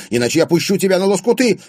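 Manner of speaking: high, loud, fast threat; indignant threat. A narrator's reading. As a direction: fire off an outraged yell. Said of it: "возмутилась". (возмущённая угроза, быстро и громко)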